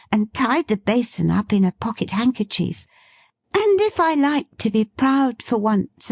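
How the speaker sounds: put-on lively girl teasing her dad; girlish Meg. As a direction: tease your father brightly.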